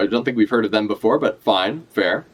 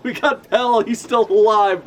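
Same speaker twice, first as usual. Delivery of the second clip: laughing; 8 semitones higher, slower than usual. Real, not acted. Repeats the name through a laugh.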